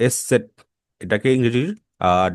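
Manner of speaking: ordinary talk, nothing marked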